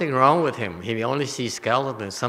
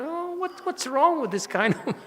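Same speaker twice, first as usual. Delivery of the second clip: very high-pitched, baffled exclamation; mock incredulity; 4 dB quieter than usual. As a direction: squeal out your mock bafflement, softly. Mock disbelief at the monk.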